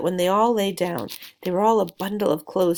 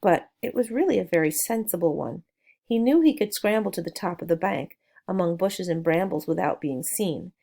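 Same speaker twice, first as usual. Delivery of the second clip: usual speaking voice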